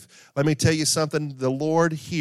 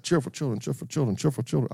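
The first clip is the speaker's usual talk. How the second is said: tongue twister attempt; faster than usual. Shown as a demonstration. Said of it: "Say that fast three times".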